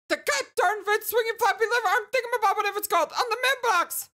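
high-pitched, frantic, stammering excitement; flustered game character. Acted. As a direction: blurt it out high, fumbling for the word.

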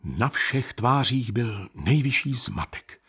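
plain reading voice

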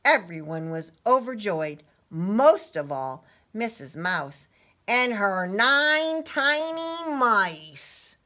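slow, drawn-out storybook flourish; stretched, rising final phrase; story reading. A narrator's reading. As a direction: build to a big reveal for children. Reads story to children.

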